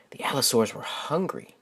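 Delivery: hushed storyteller whisper; spooky hushed warning. Acted. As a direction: whisper it to build suspense. Suspense in read-aloud story.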